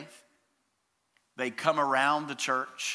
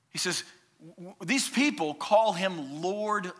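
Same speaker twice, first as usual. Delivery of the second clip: ordinary delivery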